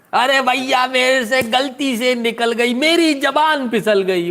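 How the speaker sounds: high, slow whiny sob-voice imitation; mock crying apology. Host mocking a tearful apology, in a high slow whine. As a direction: whimper it out like a caught child, hammed-up. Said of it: "खुद उस बच्चे की तरह रो रही".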